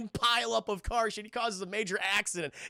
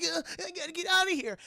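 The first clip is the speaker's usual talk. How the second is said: mock panic; 11 semitones higher, faster than usual. John fleeing in retold scene.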